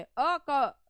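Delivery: "अ, क" with the weight on क; stress on second syllable. Shown as a demonstration. Teacher asking where the stress falls, "क" stressed. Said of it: "क जो है ना उस पे स्ट्रेस गिर रहा है".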